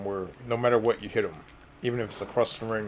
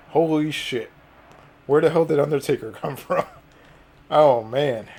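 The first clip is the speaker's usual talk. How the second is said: shocked; 4 semitones higher, 7 dB louder than usual. Real, not acted. Undertaker suddenly appears.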